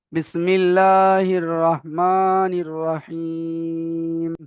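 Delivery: slow, drawn-out chanted Bismillah; Quranic recitation. Acted. Teacher beginning the surah with slow recitation. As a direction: intone it slowly, stretching each word.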